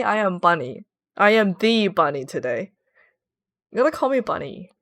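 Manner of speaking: ordinary talk, nothing marked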